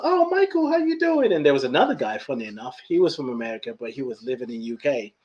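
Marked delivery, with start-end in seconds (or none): 0.0-1.3 high-pitched delighted greeting; excited greeting. Retold or imitated. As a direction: greet an old friend in a much higher voice. Retold surprised greeting in store.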